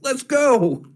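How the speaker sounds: high-pitched rallying call at normal volume. Acted. Player waves the party in as Growlnar, calling out. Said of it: "Oh, you shout that?".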